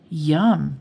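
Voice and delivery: ordinary delivery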